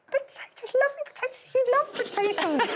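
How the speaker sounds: put-on high, soft baby talk to a dog; baby pet voice. Owner coaxing Badger to eat potatoes, high soft baby talk. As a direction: coo it up high like to a puppy. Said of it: "I've got the other voice".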